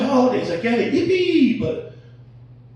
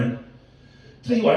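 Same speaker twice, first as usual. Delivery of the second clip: ordinary talk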